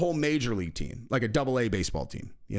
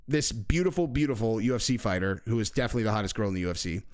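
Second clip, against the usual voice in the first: ordinary delivery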